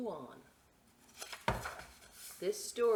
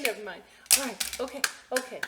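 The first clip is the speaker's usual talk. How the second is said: ordinary delivery, nothing marked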